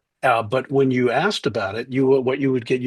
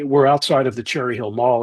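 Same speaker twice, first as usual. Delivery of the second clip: ordinary talk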